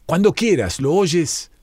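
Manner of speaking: narrated shout, done as an eager high call; calling back. A narrator's reading. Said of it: "para gritarle".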